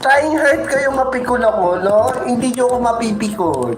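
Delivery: high protest fresh from laughing; high-pitched protest. Real, not acted. Insisting they cannot rile the speaker, high-pitched protest. Denies being riled, just after laughing.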